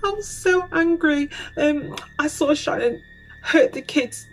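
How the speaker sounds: imitated outburst, voice leaping very high; emotional outburst. Suspect confessing to his dad, high-pitched imitated emotional outburst. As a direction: push it high as if emotion spills out. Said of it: "This is where all the emotion starts coming out".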